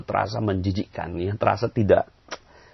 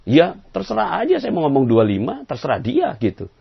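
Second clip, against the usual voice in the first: higher and louder, dismissive; the stubborn man telling the judge he doesn't care; shrugging reply; 10 semitones higher, 7 dB louder than usual. Retold or imitated. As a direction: brush the question off. Other man answers judge.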